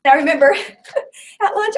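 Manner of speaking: ordinary talk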